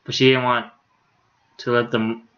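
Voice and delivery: ordinary delivery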